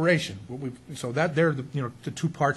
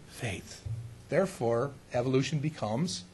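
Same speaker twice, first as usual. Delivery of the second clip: ordinary delivery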